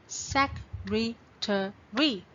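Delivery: "Secretary" slowly, with gaps between four beats; broken into syllables. Shown as a demonstration. Teacher repeating for students to count, "Secretary" split into syllables. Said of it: "So how many syllables?".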